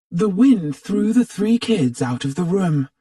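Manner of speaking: ordinary delivery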